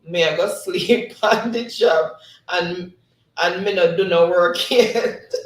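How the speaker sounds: slow, laughing read-out; real laughter spilling into the words. Real, not acted. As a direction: draw it out, then crack up loudly. Laughs reading chat comment.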